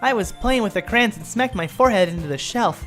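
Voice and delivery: put-on high-pitched excitable girl character; Sayori blurting out. Sayori blurts out her mishap.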